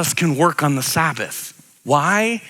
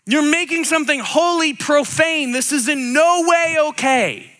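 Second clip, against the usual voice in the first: higher, outraged protest; higher, outraged religious leaders; indignant objection; 8 semitones higher than usual. Retold or imitated. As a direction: scold him for breaking the Sabbath. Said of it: "they're like, they're like".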